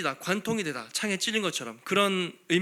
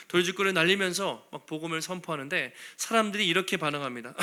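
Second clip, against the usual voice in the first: normal talking voice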